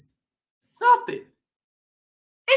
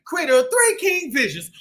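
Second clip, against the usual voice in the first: ordinary delivery, nothing marked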